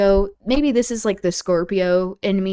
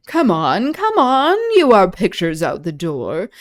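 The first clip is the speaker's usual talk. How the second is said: dramatic reading; 6 dB louder than usual. Acted. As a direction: declaim the greeting to the lady. Reads Iago's lines.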